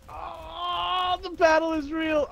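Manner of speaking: high, dramatic battle cry; thrilled in the heat of battle; excited. Real, not acted. Mid-fight exclamation.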